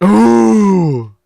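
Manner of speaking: high, loud sobbing wail; anguished sob. Acted. Deer collapsing in grief, loud sobbing wail. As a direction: let the sob burst out. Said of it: "now I'm crying uncontrollably".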